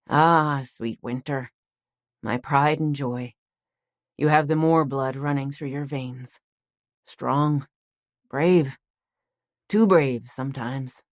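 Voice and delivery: lightly voiced grandpa, slow and fond; a hint of a proud old man; fond old grandfather.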